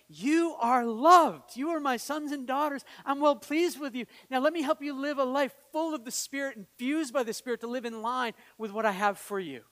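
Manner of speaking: fervent preached declaration; impassioned proclamation. Retold or imitated. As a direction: proclaim it high and strong, then ease slightly. Preacher proclaims God's words.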